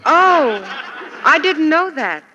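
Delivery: ordinary talk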